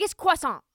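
croissant said the French way, kwah-sahn; mock-posh French croissant as kwah-sahn; correcting someone's croissant. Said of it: "correct you for saying croissant".

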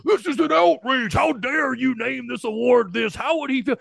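high, whiny fake outrage; mock outraged fan. Host mocking angry commenters, in a high outraged whine. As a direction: ham up an offended Facebook commenter. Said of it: "people freaking out. Like".

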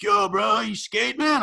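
high-pitched mock skater bro; hammed-up skater-dude voice. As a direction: imitate an eager skater kid calling out. Imitates skater kids in a story.

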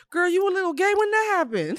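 put-on high, teasing girlfriend voice; mock-shocked sing-song; teasing quote. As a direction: tease her, way up high. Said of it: "I was like".

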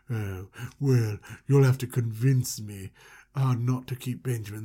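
creepy ruler voice. Retold or imitated. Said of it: "And he's like".

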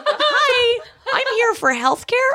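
high, laughing naive voice; naive Canadian self. Retold or imitated. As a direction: play your naive younger self, pitched high. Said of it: "Hello, I'm [name]".

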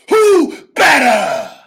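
very high, loud, slow bellow on who; roared catchphrase. Shown as a demonstration. Wrestler showing full-force delivery, roared. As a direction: yell it to the back row. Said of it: "Which one's better?".

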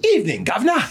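hammed-up British evening greeting; cartoonish British "governor"; mock British accent. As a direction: tip an imaginary hat in fake British. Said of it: "My man dropped a governor".

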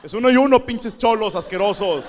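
loud, outraged shout, laughing through it; indignant shout. Retold or imitated. Boxer protesting to his coach, loud indignant shout. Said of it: "Volteé con mi coach".

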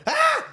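very high, short shriek; quick mock scream mid-story; imitated scream. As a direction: mimic a startled yelp. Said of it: "'cause she went".

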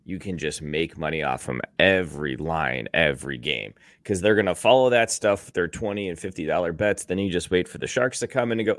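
usual speaking voice

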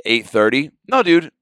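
ordinary talk, nothing marked

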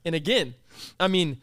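ordinary talk

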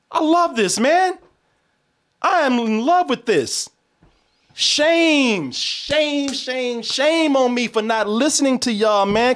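high, rapturous declaration. Real, not acted. Telling viewers he loves the show, rapturous and high. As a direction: declare it like a swoon.

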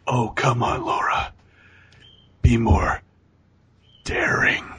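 slow, drawn-out creepy coaxing; mock creepy doctor, half whispered at the end. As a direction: tempt her like a sleazy doctor. Imitating creepy doctor tempting her.

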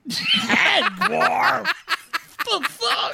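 high laughing disbelief; incredulous laugh. Real, not acted. Disbelief at partner's answer.

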